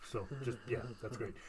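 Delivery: soft unvoiced whisper. Real, not acted. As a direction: breathe it out in a whisper. Whispered aside.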